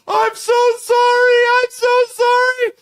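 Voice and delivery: high, slow wailing falsetto sob; mock sobbing. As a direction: ham up a squeaky, blubbering apology. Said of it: "she's just sobbing uncontrollably".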